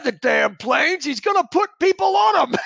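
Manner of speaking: lightly imitated Australian accent, high-pitched. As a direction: put on an excited Australian accent. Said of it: "In this great Australian accent".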